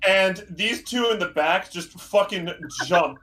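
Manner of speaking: ordinary delivery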